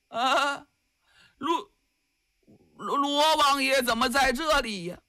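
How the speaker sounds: high, stammering shock; a captive startled to see the prince, stammering. Acted. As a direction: stumble over the name in alarm. Captive suddenly sees the prince.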